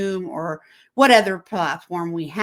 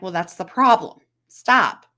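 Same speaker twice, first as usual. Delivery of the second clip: raised voice, louder on the second sentence; firm loud telling-off; 6 dB louder than usual. Retold or imitated. Counselor warning a client who only texts, firm raised voice.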